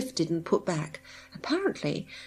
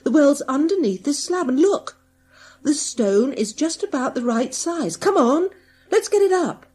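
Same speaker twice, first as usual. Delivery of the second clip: louder, higher, rising excitement; excited; 7 semitones higher, 7 dB louder than usual. A narrator's reading. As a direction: build up the thrill of discovery. Searcher excitedly finds the well.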